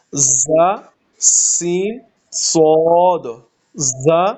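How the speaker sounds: za, seen, saad with a whistling hiss; slow letter demo; demo letter sounds. Shown as a demonstration. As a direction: sound out زا، سین، صاد with sharp sibilants. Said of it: "پھر سنیں".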